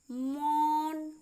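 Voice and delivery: मौन slow and loud, au drawn right out; long au. Shown as a demonstration. Teacher modelling au for learners, long held vowel in मौन. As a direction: stretch the au in मौन. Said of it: "अधिक समय लगता है".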